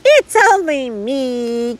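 stretched, lightly voiced monkey line; giggling monkey. A narrator's reading. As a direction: draw it out as a playful little monkey. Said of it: "giggled a monkey".

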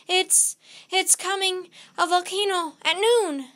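loud, high, halting outburst; panicked warning. A narrator's reading. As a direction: blurt the warning out in bursts. Jack blurts the eruption warning.

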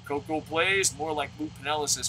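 usual speaking voice

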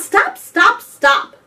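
loud, high repeated yelps; yelling. A narrator's reading. Benji yelling at the splashing dugong. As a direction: yell each STOP separately and urgently. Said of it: "Benji yelled".

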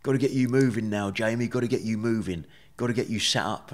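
a light Essex nurse, brisk and cheery; Essex accent. Retold or imitated. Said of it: "I'm not very good at the accent".

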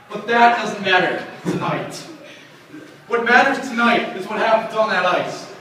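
ordinary delivery

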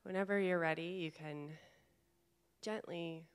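calm meditation guide, long pauses between phrases; guided meditation. Real, not acted. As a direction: gently guide them inward, leaving space to breathe. Leads a guided chakra meditation.

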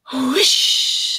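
breathy gust of wind sound; huffing whoosh. Acted. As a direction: blow it out like a gust. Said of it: "huffed the north wind".

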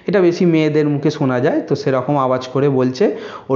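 ordinary talk, nothing marked

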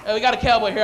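normal talking voice

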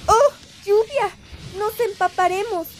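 high, excited cry at the rain; excited exclamation. Acted. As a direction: call out as the rain starts. Acted excitement at sudden rain.

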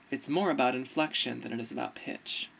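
low-pitched inflection demo. Shown as a demonstration. Showing inflection versus pitch, in the low old voice. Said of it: "So you can hear it".

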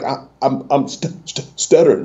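halting, broken-up stammer; re-enacted childhood stutter; imitated stutter. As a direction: catch on the first sounds and repeat them. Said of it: "you know, I'm stuttering".

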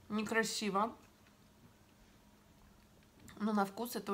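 talking while chewing food; speaking around bites of meat; mouth full, chewing. Real, not acted. Seen 0: bites duck tongue at 0.5–3.8, then visibly chews while talking.

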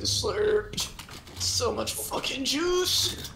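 high, slow and stretched; drawn-out hype. Real, not acted. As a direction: savour it, pitch way up. Drinking slurp juice.

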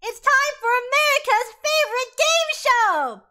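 high, loud announcer hype; game show host. Acted. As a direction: belt out the show's big intro.

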